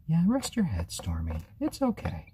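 high, gentle coo; soothing pet talk. Real, not acted. Telling Stormy to rest, high gentle coo. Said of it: "calm and soothing".

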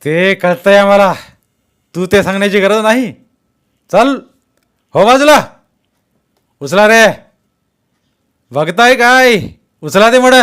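high, very loud dismissal and orders; aggressive shouting. A narrator's reading. Masked man orders his men past Jaydeep, shouting angrily. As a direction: brush him off and bark at the men.

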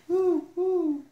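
very high, loud hoots; owl hoot. Acted. Reader reviews the owl's sound, hooting high. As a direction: hoot loudly in a high head voice. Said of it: "He can sound like an owl".

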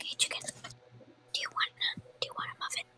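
soft unvoiced whisper. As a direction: breathe it, no voice. Whispering to in-game chicken.

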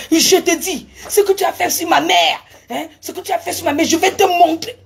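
furious threat. Retold or imitated. Speaker threatening the woman about her mother, furious loud threat. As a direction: threaten her over your mother, enraged.

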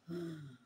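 sharp gasping breath imitated; mother's gasp. Retelling her mother's last breath, a sharp gasp. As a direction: gasp for air like a dying woman. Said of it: "Yun yung hingal niya".

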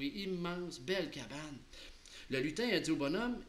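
plain reading voice

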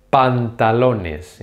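Pantalones stretched out, pan-ta-LO-nes; very slow Spanish. Shown as a demonstration. Teacher modelling Pantalones slowly. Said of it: "pronouncing them very slowly".

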